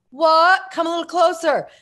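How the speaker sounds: high, loud shout down to someone below; hollered reply, pitched high; mock calling down. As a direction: call down from a window, playfully. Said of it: "I go".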